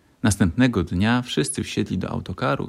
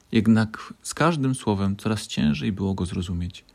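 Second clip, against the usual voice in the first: ordinary delivery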